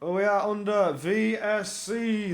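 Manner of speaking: ordinary talk, nothing marked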